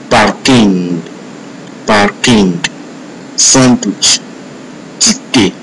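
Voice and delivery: "pardon, important, parking, sandwich, ticket" said as French words; English-looking words like "parking" and "ticket" voiced in French; French pronunciation. Shown as a demonstration. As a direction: give "pardon" and "important" French nasal endings with silent final consonants. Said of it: "are almost always pronounced differently".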